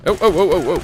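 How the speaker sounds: high, loud rapid oh oh oh; excited yelps. Real, not acted. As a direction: yelp in excited alarm. Surprised in firefight.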